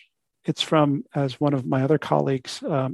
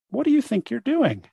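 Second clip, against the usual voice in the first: higher-pitched storybook challenge; questioning sea; 8 semitones higher than usual. Acted. Great sea calls out to the unhappy fish, questioning. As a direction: ask the silly fish in a lilting, raised pitch.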